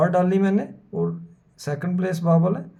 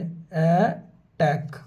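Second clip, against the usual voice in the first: Attack drawn out slowly with a falling end; slow sounding out; much slower than usual. Shown as a demonstration. Teacher to students, sounding out the word slowly. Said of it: "इसी तरह attack".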